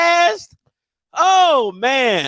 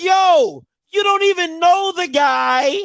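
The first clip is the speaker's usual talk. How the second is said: parent scolding; 5 semitones higher, slower than usual. Retold or imitated. Scolding son, parent mode.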